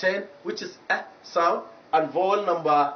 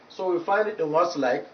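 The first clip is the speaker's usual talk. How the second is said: ordinary talk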